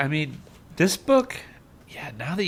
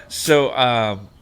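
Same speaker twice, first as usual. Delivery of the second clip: ordinary talk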